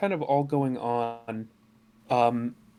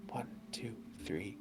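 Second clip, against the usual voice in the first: whispered counting; 6 semitones lower, 21 dB quieter than usual. Acted. Asked to count again.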